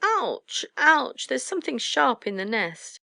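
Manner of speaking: high falling yelps of pain; high-pitched storybook ouch; pained yelping. A narrator's reading. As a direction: yelp twice, then complain. Owlets pricked by thorns.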